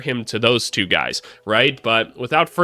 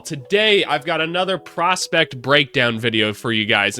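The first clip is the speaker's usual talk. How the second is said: ordinary delivery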